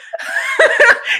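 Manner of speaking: a high burst of real laughter; laughing burst. Real, not acted. Host laughing about the gushing chat, high real laugh. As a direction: let a bright laugh escape.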